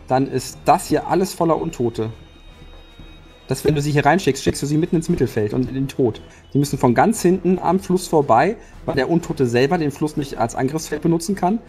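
ordinary talk, nothing marked

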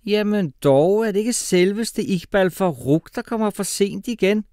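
loud, high, drawn-out mock astonishment; nasty teacher's shout. A narrator's reading. Horrible teacher to late pupil, shouting sarcastically. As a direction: shout it slowly at the latecomer. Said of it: "råbte vores afskyelige og lede lærer".

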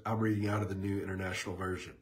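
ordinary talk, nothing marked

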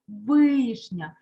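вишня with a loud, long «и»; stressed check word. Shown as a demonstration. Teacher points to the check word, вишня with stressed «и». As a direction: lean on the first vowel of вишня. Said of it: "Звертаємо увагу на перевірне слово".

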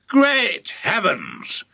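slow, high exclamation; astonished cry. A narrator's reading. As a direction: draw it out in amazement. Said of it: "he cried".

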